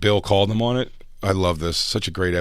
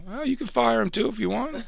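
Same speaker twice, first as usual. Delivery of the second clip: much higher casual mock voice; imitating Patrice. Patrice brushing off the firing, high and casual. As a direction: toss it off carelessly. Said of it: "He was like".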